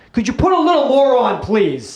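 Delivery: high mock pleading; mock request. Acting out a request for more sugar.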